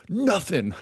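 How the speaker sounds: high, whiny little victim voice; little inner victim. Acted. Inner victim denying any part in depression, high and whiny. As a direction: squeal it as a small put-on victim. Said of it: "the little victim inside of me".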